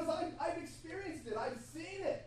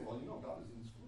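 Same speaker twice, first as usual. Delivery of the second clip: knowing quip; 9 dB quieter, much faster than usual. Shown as a demonstration. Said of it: "now you can say".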